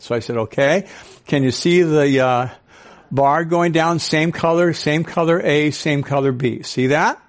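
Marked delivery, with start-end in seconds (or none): none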